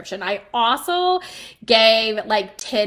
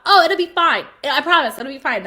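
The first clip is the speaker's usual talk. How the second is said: breezy assurance; 5 semitones higher, 7 dB louder than usual. Retold or imitated. Husband's cousin-in-law brushing off warning.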